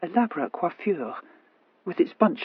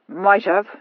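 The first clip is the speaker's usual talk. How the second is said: judicial; 2 semitones higher, 7 dB louder than usual. Acted. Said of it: "said Sir Rowland judicially".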